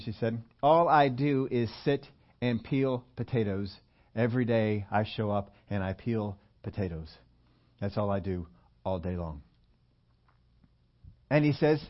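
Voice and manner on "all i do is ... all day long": downcast humble admission. Retold or imitated. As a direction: voice a weary woman owning up to drudgery. Woman admits her humble job.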